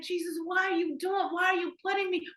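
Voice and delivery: high, pleading question to Jesus; exasperated inner plea. Retold or imitated. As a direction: toss it up to heaven in disbelief. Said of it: "in my mind, I'm like".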